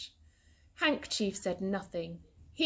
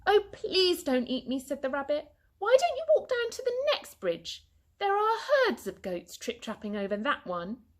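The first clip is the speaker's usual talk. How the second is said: pleading rabbit; 8 semitones higher, 3 dB louder than usual. A narrator's reading.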